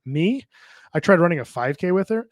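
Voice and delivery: usual speaking voice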